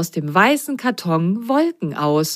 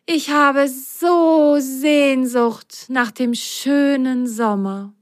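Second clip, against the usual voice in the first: slow, wistful high voice; longing; 7 semitones higher, slower than usual. Acted. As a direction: sigh for summer, slowly. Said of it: "schlug Biene vor". (sehnsüchtig und langsam)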